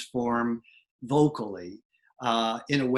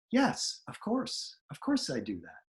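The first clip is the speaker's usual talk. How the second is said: mock assurance; 7 semitones higher, 5 dB quieter than usual. Actor to casting caller on the phone, mock assurance. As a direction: play an actor covering up with a quick yes. Actor bluffing on audition call.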